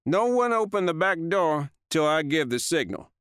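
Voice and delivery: high, firm voiced order; Stubbs ordering. A narrator's reading. Stubbs orders the team to hold, firmly voiced.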